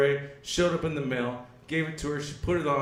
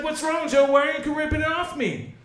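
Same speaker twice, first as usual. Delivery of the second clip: higher-pitched questioning woman's part; imitating his girlfriend; 10 semitones higher than usual. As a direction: raise the pitch to mimic a puzzled girlfriend. Voicing his puzzled girlfriend.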